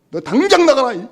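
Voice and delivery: high, loud order out; a father ordering his son out of the house; angry shout. Retold or imitated. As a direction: throw him out at full voice. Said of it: "이런 소리 하니까".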